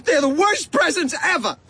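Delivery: very high, rushed wailing rant; red-faced tantrum. A narrator's reading. Boy raging at Ma about presents, high tantrum. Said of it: "My face turned beet red".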